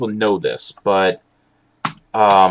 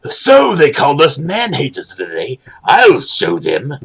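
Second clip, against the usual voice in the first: higher, put-on defiant voice, last words drawn out; hammed-up vow of revenge, pitched up; mock indignant impression; 6 semitones higher, 5 dB louder than usual. Streamer mimicking someone called a man-hater, mock indignant. Exaggerated second mimicry of the group.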